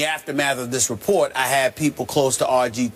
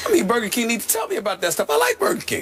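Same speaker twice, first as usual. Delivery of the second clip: excited plea; 10 semitones higher, faster than usual. Real, not acted. Excited plea to Burger King.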